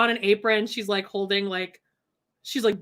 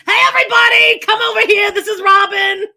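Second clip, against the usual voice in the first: loud, high hollered introduction; put-on character voice; 11 semitones higher, 10 dB louder than usual. Calling the family over to meet Robin, loud put-on yenta voice. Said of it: "I'm impersonating someone and I can't".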